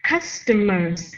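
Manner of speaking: slow, falling tune on Customers; falling end. Shown as a demonstration. Teacher modelling a word, falling tune. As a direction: let Customers drop at the end. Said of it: "lower your voice at the end of the word customers".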